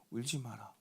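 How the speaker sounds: tender comfort. Acted. Jesus comforting the grieving widow, soft and tender. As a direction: say it like calming a crying child.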